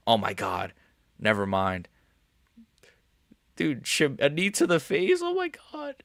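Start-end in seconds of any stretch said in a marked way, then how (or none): 3.5-5.9 shocked disbelief. Real, not acted. Streamer watching a knee land, shocked. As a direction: voice jumps high in disbelief. Reacting live to fight knee.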